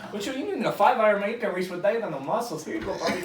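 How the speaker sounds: brash Cuban caddy handing over the club; Tony Montana Cuban accent. Retold or imitated. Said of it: "like, Tony Montana accent".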